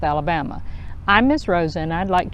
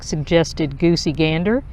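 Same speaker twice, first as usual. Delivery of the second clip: ordinary delivery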